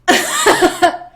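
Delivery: loud high staccato laugh; hammed-up loud cackle; acted outburst. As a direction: burst out in high, rapid cackles. King's reaction to the confession.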